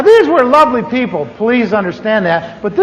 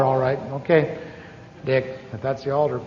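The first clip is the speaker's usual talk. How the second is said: grudging mutter; 7 semitones lower, 11 dB quieter than usual. Retold or imitated. Grudging reply, quiet in church.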